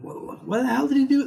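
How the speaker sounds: high-pitched imitated disbelief; upset Tony. Fan imagines Tony reacting to Doctor Strange, upset disbelief. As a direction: leap up high, incredulous but not yelling. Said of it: "when he was reacting to Doctor Strange".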